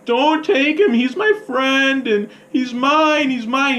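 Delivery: put-on high, drawn-out child's plea; mock-crying kid, high and insistent; tearful pleading. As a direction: plead like a boy close to tears. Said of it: "He starts crying".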